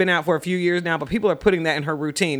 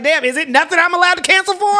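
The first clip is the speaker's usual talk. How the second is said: high mocking whine; exasperated high-pitched mimic; mocking single person; 12 semitones higher, 5 dB louder than usual. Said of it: "you be like".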